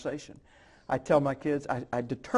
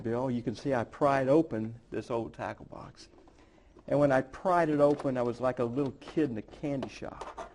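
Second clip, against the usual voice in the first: normal talking voice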